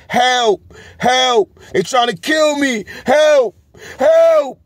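high-pitched imitation of desperate pleas; retold cries for help. Prisoner being beaten in the day room, imitated high cries for help. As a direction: call out like a victim begging for rescue. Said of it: "hear this man cries".

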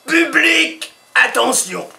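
loud, high call to the audience; public warning. Real, not acted. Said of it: "j'avertis mon public". (avertissement lancé haut et fort)

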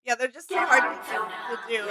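ordinary talk, nothing marked

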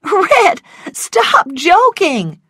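high-pitched, slow cry of alarm; distressed plea. A narrator's reading. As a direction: plead loudly, stretching the words. Scarlett begs Rhett to stop joking.

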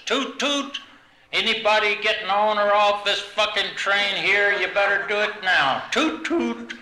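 put-on high-pitched kid calling like a train conductor, toots way up high; bossy little boy playing train on his bike; childish conductor call, squeaky toots.

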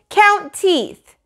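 put-on high toddler demand; little kid voice. Fudge asks the dentist, high toddler voice. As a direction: chirp it like a small child. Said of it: "he said".

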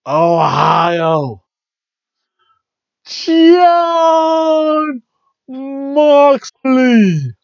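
high, loud booming ring announcer. Pretend. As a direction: stretch out the name like a ring intro. Ring announcer introduces challenger.

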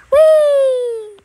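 high, loud, gliding squeal; long whee. Pretend. Hero flying away in play, squealing whee. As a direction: squeal it, sliding down. Character flies off.

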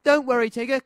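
high, loud reassuring call. A narrator's reading. Pooh to Tigger stuck in the tree, loud high calling. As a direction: call up to a friend in trouble. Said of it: "called Pooh".

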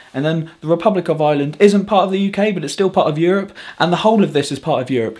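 normal talking voice

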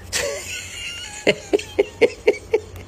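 high burst of laughter that falls away; real laugh. Real, not acted. Comedian laughing at his own ruined weekend, real laughter. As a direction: let out a big real laugh at yourself.

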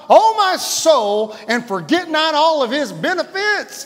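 high, loud, drawn-out proclamation; impassioned pulpit shout; full-force preaching. Real, not acted. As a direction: cry out the psalm to rouse the congregation.

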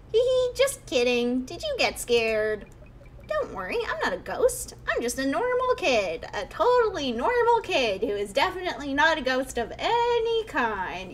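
put-on high, bouncy little kid teasing the player. Ghost kid teasing the startled player, high little kid voice. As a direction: play a cheeky ghost kid pretending to be normal. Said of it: "I'll just give it a little kid voice".

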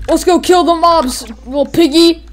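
rally your little pet for battle; playful rallying call. Pretend. Player voicing a character talking to a piggy, eager call.

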